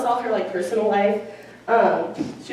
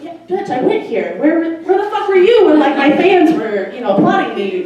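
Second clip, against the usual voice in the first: sassy comeback; 8 semitones higher, 7 dB louder than usual. Retold or imitated.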